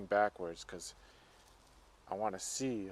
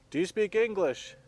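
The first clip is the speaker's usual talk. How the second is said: loud, high-pitched question; calling out; 9 semitones higher, 9 dB louder than usual. Real, not acted. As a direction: call it out to someone unseen. Calling out to unseen presence.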